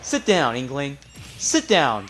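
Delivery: taunting. Real, not acted. Player trash-talking the Inkling, loud taunt. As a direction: taunt the beaten fighter.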